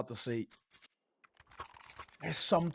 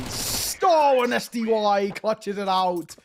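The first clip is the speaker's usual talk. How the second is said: excited caster yell; 6 semitones higher, 8 dB louder, much slower than usual. Real, not acted. Caster calls the clutch win.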